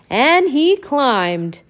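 storyteller building the climb; climbing effort. Acted. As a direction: heave up the last climb.

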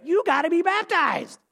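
high, emphatic mock preacher cry; hammed-up urgent demand from a hardliner; insistent believer. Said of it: "that, that really emphasize this sacramental view of salvation say".

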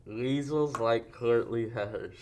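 slow, garbled through a mouthguard; wearing a mouthguard. Real, not acted. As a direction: force the words past a mouthguard. Speak Out game with mouthguard.

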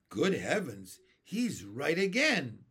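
loud, drawn-out exclamation; swooping storybook amazement; delighted cry. A narrator's reading. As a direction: cry out as an impressed old grasshopper. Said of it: "cried the old green grasshopper".

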